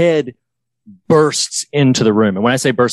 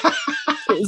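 high squeaky laugh; 6 dB quieter than usual. Real, not acted. Laughing while retelling.

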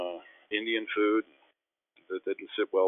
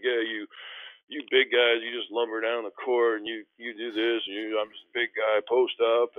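mocking big guys; 3 semitones higher than usual. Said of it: "he was making fun of big guys".

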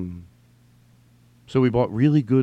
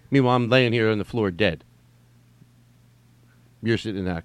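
ordinary talk, nothing marked

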